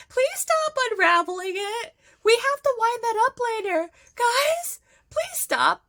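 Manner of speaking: loud, high-pitched frantic plea; pleading yell. Retold or imitated. Fan sites begging the boys to stop unraveling yarn, mock pleading shout. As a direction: call out urgently, high and loud, imitating.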